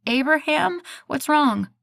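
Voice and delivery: high, loud startled question; alarmed. A narrator's reading. Woken by her boyfriend's call, alarmed and high. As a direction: jump straight to worry.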